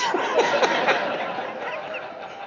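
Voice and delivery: a squeaky high burst of laughter; high breathy laugh. Real, not acted. Laughing at the memory.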